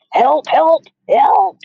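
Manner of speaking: very high, loud panicked screams; screaming for help. A narrator's reading. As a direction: scream for help, rising each time. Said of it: "the man was screaming".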